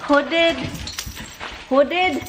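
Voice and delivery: high, accusing question; scolding pets. Real, not acted. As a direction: demand a culprit in a high pet-scolding voice. Questioning pets over a mess.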